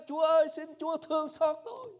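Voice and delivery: loud desperate plea; crying out. Acted. Exhausted leper begging Jesus, loud desperate cry. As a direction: shout a last plea with all your strength. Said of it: "ráng mà la lên".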